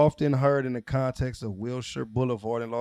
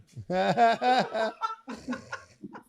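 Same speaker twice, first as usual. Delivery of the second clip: high, long burst of laughter; real laughter; 9 semitones higher than usual. Real, not acted. Friend cracking up at a mispronounced street, real laughter. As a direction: crack up for several beats.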